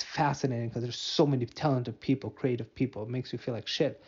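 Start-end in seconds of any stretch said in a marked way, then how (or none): none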